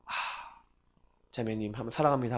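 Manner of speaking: a quiet loving greeting after the service; soft tender pastor. Retold or imitated. Pastor embracing a grieving woman, soft and tender.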